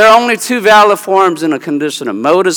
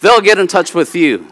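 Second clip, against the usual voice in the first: ordinary talk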